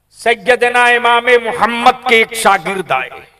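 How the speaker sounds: high, loud preaching shout; fiery preaching. Acted. Preacher warning the gathering about their children, fiery shout. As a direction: cry it out to the crowd.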